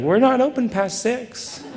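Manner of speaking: bright, detached store-hours voice; cheery business voice. Retold or imitated. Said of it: "her business voice".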